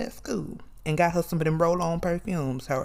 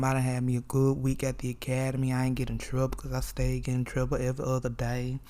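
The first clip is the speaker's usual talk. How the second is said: normal talking voice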